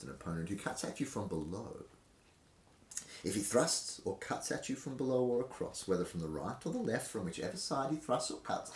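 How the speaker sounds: put-on measured, deliberate reading of an old manual; formal reading aloud. Presenter reads an old fencing manual to viewers, formal reading voice. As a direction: read it like a period text, clause by clause.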